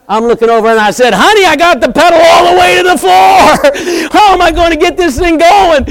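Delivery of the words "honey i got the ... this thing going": shouted high and loud at his wife; retold panicked shout; frustrated shout. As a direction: yell it in panic, foot on the floor. Retelling panic at stalled truck.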